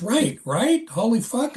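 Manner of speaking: high, excited confirming; eager agreement. Retold or imitated. Coworker agrees with the narrator, high and excited. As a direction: egg him on. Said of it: "And he goes".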